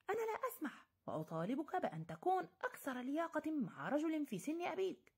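very high, offended protest; indignant outburst. Acted. Dr Richard indignantly rebuking the young man. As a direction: refuse the insult outright. Heated quarrel, offended elder.

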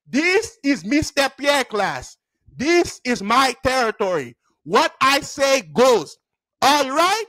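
hammed-up classroom authority, each word weighted; domineering teacher. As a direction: imitate an accented teacher laying down the law. Teacher lays down law on desk.

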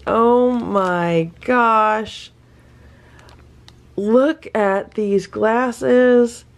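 slow high excited gushing. Real, not acted. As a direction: coo over something adorable. Unboxing cute glasses.

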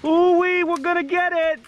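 high, thrilled whooping cheer; excited whoop. Real, not acted. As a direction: whoop it out in triumph. Whooping after a win.

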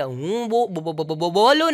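a stammerer explaining why the calls get cut; stammering. Retold or imitated. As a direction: keep catching on the first sound.

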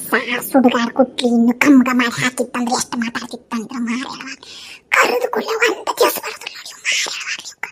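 soft, whispery grumbling; muttered aside. Acted. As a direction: curse her under your breath. Mother-in-law grumbles aside about daughter-in-law. (ಸೊಸೆಯ ಬಗ್ಗೆ ಮೆಲ್ಲನೆ ಗೊಣಗುವುದು)

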